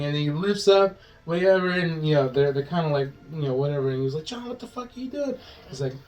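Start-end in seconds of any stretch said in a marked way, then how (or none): 4.2-5.4 high, hushed mock yell; mock outcry. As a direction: imitate a shocked protest, high and soft. Retold partner protests the torture.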